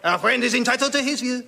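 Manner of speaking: put-on high, soothing-pompous French accent; outrageous French accent. Voltaire calming the swordsman in a French accent. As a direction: wave him down grandly, French style. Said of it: "in outrageous French accent".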